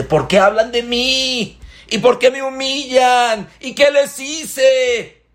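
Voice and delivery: mock aggrieved wail; wounded victim. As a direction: plead your innocence. Victim of gossip laments.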